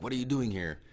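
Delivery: high-pitched questioning; mock interrogation. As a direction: imitate a captor demanding an answer. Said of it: "the guy is questioning".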